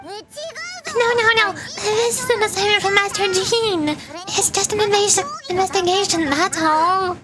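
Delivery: high-pitched, panicky sidekick voice; flustered game sidekick. Acted. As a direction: hurriedly deny it in a high little voice. Voices Paimon's flustered denial.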